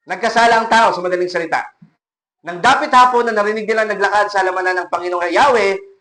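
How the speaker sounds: ordinary talk, nothing marked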